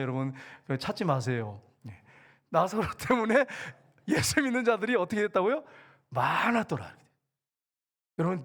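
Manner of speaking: ordinary talk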